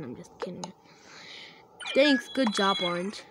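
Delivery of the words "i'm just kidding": soft whisper, breath only; hushed joking whisper; whispered aside. Real, not acted. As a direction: whisper it like a secret. Aside after joke.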